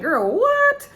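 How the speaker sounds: high, loud mock-stunned reaction; exaggerated disbelief. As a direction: shoot way up high on a baffled "what". Her stunned reaction to Katie's dodging.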